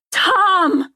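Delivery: high, loud yell of a name; piercing one-word cry. A narrator's reading. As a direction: yell out to him. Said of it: "I yell".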